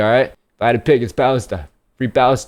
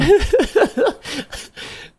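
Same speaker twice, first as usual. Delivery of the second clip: high laugh; 3 dB quieter than usual. Real, not acted. Laughing at Juan's remark.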